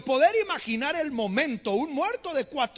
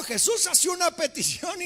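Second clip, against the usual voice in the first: normal talking voice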